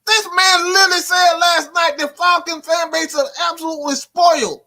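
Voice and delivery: high, shouted rant; heated rant. Real, not acted. Vlogger shouting at a commenter about the fan base. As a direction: shout it out in frustration.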